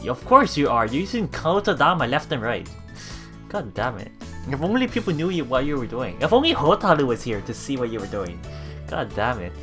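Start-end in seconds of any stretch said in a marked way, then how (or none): none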